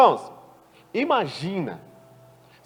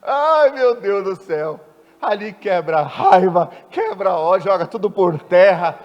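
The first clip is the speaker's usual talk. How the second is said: louder, slow impassioned preaching; fervent preaching; 7 dB louder, slower than usual. Acted. Preaching builds to full force.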